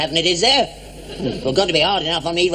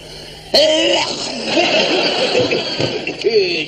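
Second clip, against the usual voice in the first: big hacking cough; 7 semitones higher than usual. Acted. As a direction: cough hard to prove a point. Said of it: "That's a cough".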